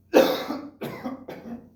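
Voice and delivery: loud, hacking cough; acted cough. Father in front of his concerned son, loud acted cough. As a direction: break into a loud coughing fit. You see about that.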